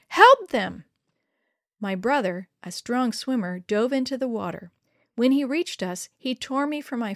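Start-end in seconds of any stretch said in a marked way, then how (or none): 0.1-0.8 loud, high-pitched urgent command; screamed plea. A narrator's reading. Mother sending her son to the rescue, screamed plea. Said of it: "she screamed".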